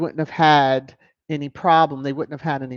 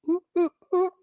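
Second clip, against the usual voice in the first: high teasing oohs; faster than usual. Real, not acted. Reacting to the quote.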